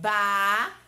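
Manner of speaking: BA-NA-NA with long pauses between syllables; one loud mouth-opening per syllable of "ba-na-na"; ba, na, na as three widely separated beats. Shown as a demonstration. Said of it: "É quantas vezes eu abro a boca".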